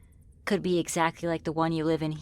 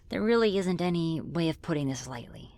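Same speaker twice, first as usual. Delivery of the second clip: ordinary talk, nothing marked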